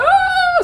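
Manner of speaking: high pained cry; short scream. Acted. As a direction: yelp in pain. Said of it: "You hear a few, like".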